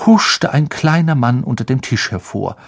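plain reading voice

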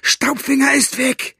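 very high, strained cry of alarm; high-pitched exasperated shout; angry outburst. A narrator's reading. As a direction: snap out the news in fury. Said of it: "fuhr Basta ihn durch die Gitterstäbe an".

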